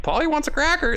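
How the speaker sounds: very high squawky parrot; high mock parrot. Parrot impression in story.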